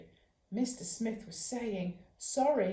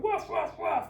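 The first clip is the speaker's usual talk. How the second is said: yappy bark; 8 semitones higher, 4 dB louder than usual. Retold or imitated. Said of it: "He'll tell them off like".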